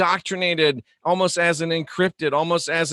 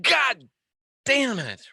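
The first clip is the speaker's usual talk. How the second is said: mock pained curse; 7 semitones higher, slower than usual. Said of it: "What do you say?".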